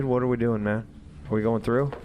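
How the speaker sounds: usual speaking voice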